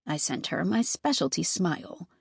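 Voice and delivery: plain reading voice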